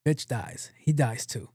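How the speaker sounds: ordinary delivery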